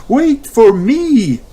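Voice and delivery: high, slow loud call. Acted. Mummy running after family, calling. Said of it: "cries Mummy Pig".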